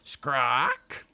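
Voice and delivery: a voice screaming at the other end of the line; screamed. A narrator's reading. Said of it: "screamed a voice at the other end".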